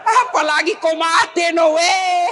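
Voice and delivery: loud, laughing punchline. Pretend. Joking to his wife that he would remarry, loud and laughing. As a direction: blurt it out with a laugh.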